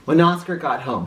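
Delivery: ordinary delivery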